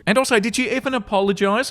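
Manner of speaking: ordinary talk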